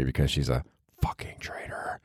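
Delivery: hushed, teasing jab; whispered aside. Pretend. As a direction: whisper it as a joke. Mock insult aside to friend.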